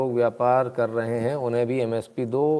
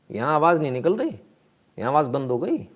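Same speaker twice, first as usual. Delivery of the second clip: ordinary talk, nothing marked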